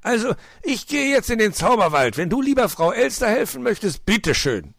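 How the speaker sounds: loud, high, exasperated ultimatum; heated, emphatic storybook fox; angry outburst. A narrator's reading. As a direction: snap angrily at the nephew. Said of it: "denn sein Ausbruch tat ihm leid".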